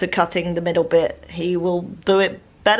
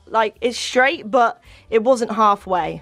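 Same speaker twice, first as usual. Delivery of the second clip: normal talking voice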